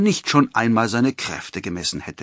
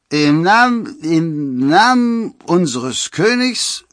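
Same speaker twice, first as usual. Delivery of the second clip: high, loud, halting stammer; loud stutter with drawn-out words; 8 semitones higher, 9 dB louder, slower than usual. A narrator's reading. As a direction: stumble and restart, outraged. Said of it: "stotterte einer der Förster".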